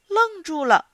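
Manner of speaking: ordinary delivery, nothing marked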